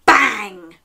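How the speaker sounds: explosive, falling door slam; big, punchy storytime sound effect; loud bang. A narrator's reading. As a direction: shout it like a slamming door.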